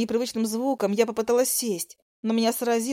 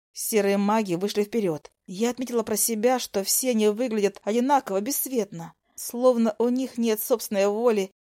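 plain reading voice

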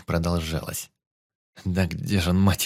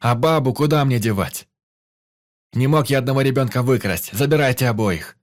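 loud, higher, arguing into the radio; raised voice; 4 semitones higher, 5 dB louder than usual. Acted. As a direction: push back hard, almost shouting. Arguing angrily over the radio.

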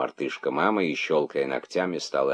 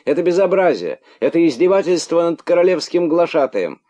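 high, loud outraged yelling; shouting in wounded pride; indignant shout; 13 semitones higher, 8 dB louder than usual. A narrator's reading. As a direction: rant at the top of the voice. Outraged character keeps shouting.

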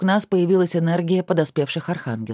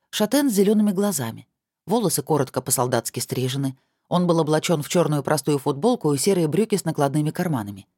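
ordinary delivery

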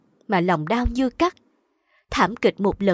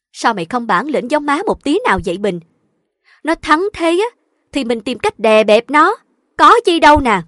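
louder, building to a forceful finish; scolding mother; 5 semitones higher, 8 dB louder than usual. A narrator's reading. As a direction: goad the daughter impatiently. Mother berates daughter for lacking nerve.

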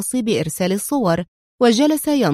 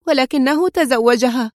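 sadly; 4 semitones higher than usual. A narrator's reading. Daughter answering her father, a touch sad. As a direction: say it with mild hurt. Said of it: "قالت حزينة".

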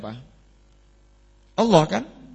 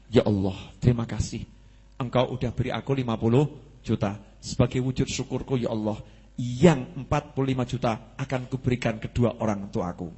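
lower, softer, sincere thanks to God; quiet heartfelt prayer; 6 semitones lower, 3 dB quieter than usual. Retold or imitated. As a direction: pray it gently and humbly. Imagined grateful prayer, lower, softer. (doa syukur yang lirih dan tulus)

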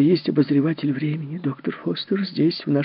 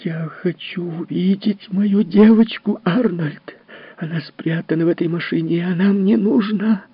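furious demand; 11 semitones higher than usual. Acted. Enraged mother demands to see her girl. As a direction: demand your daughter back. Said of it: "в разъярённую фурию".

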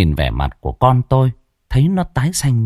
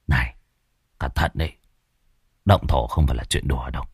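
low warning; 8 semitones lower, 6 dB quieter, faster than usual. Acted. Colleague warns quietly.

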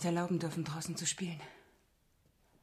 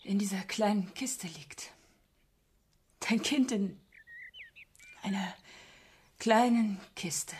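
grief-stricken whisper; 2 semitones higher, slower than usual. Acted. Mother grieving her dead child.

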